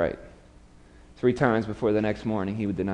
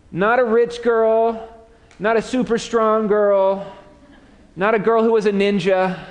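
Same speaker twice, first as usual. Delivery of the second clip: mocking rebuke; 8 semitones higher than usual. Preacher taunting Peter's cowardice.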